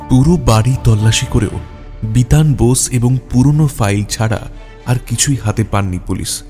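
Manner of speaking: ordinary talk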